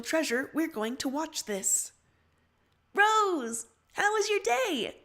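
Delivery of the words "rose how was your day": higher, louder cheery greeting; bright greeting. Acted. John greeting Rose in the group chat, cheery and high. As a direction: greet her brightly, pitched up.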